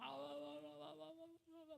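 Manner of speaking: high, strained groans of a prayer beyond words; wordless aching moans, lightly imitated; wordless groaning prayer. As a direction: groan out to God, unable to speak. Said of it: "막 이렇게 하고 기도하는 거예요".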